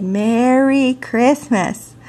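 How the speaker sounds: slow, over-articulated "Merry Christmas"; child carefully using speech-therapy rules on "Merry Christmas"; careful practiced speech. Retold or imitated. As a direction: say "Merry Christmas" slowly, shaping each sound as drilled. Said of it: "remembering my rules about speech therapy".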